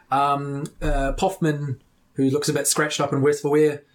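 usual speaking voice

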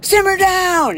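very high mocking yell of simmer down; mock shout. Mocking the drunk man yelling at the cars, very high mock yell. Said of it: "throws his arms up in the air, like".

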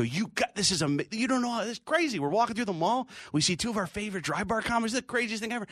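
mock-thrilled fans in a high voice; excited fans. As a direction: gush high, imitating starstruck fans. Retold couple thrilled to meet comics.

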